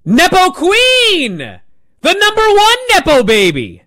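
loud, high-pitched interjection, stretched wide; Charlotte Flair. Retold or imitated. Wrestler jumps in off script on her rival, shouting high. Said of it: "Charlotte jumps in with".

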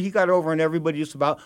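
ordinary talk

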